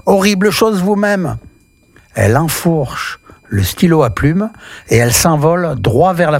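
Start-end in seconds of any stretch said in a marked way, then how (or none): 0.1-1.4 high, indignant outburst thrown right back; indignant witch cry. A narrator's reading. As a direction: snap back at the teacher, offended. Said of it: "en criant".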